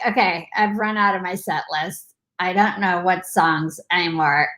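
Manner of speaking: worn-out resignation. Acted. Imitating someone out of songs by week seven, mildly worn out. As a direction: let it sag like someone giving up.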